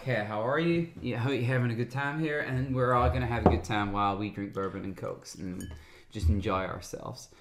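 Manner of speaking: low, easy American accent; relaxed American host voice. Shown as a demonstration. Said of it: "when I've just been doing an American accent".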